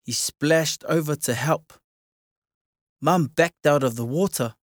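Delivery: usual speaking voice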